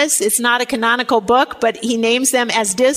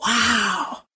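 ordinary talk, nothing marked

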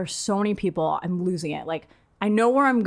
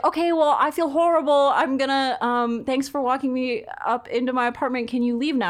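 mortified, hurrying him out; mock frantic politeness; awkward dismissal; 6 semitones higher than usual. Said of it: "I was like".

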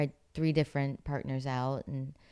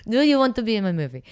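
higher, louder mock Italian accent; Italian director accent; 8 semitones higher, 9 dB louder, faster than usual. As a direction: ham up an Italian director's broken English. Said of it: "That's an excellent Russian accent".

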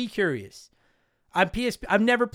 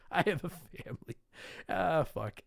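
soft, giggling mock repeat; laughing repeat; 3 semitones lower, 9 dB quieter than usual. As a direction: quote it back through laughter.

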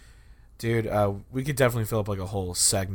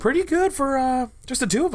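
high silly voice. Pretend. Mock high voice closing.

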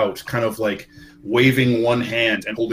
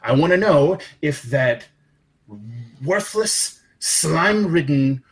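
higher-pitched, sneering giant boss voice; menacing giant boss; 4 semitones higher than usual. Acted. Ogre boss berating a henchman, sneering threats. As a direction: threaten the underling with contempt. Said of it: "another voice, speaking giant as well, says".

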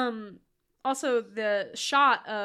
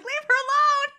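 much higher, louder protest; mock shout; 8 dB louder than usual. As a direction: yell to save her. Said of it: "I was like".

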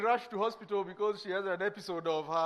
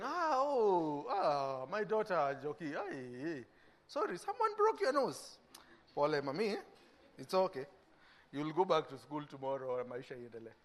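meek consoling; 6 semitones lower, 6 dB quieter than usual. Retold or imitated. Parent consoling bullied child meekly.

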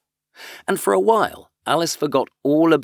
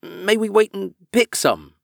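pleading; 9 semitones higher than usual. Acted. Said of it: "Alice pleaded".

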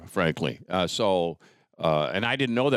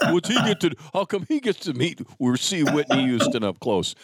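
jealous friends; 6 semitones higher than usual. Retold or imitated. Jealous friends voiced in retelling.

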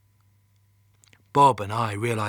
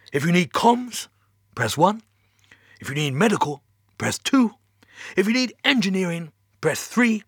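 higher, lilting automated greeting; recorded phone menu; 7 semitones higher, 6 dB louder than usual. Acted. Mission Control's recorded menu to a caller, high lilting announcement. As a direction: list options like a chirpy recording.

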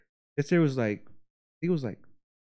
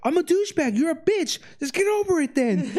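very high mocking comeback; mock arguing man. Imitating the neighbor guy's comeback, high and mocking. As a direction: shrug it off in a cartoon voice.

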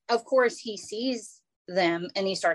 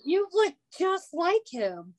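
embarrassed lady; 8 semitones higher, slower than usual. Retold or imitated. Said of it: "And she goes".

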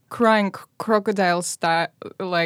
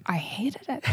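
whisper; 4 semitones higher, 9 dB quieter than usual. Real, not acted. Confessing childhood hatred.

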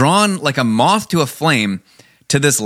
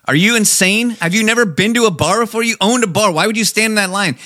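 incredulous; 7 semitones higher than usual. Retold or imitated. Retold scolding of friend.

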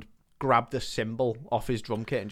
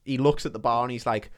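ordinary talk, nothing marked

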